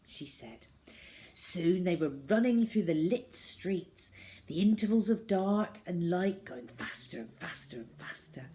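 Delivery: ordinary delivery, nothing marked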